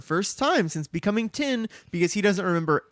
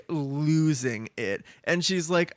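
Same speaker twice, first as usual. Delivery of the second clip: ordinary delivery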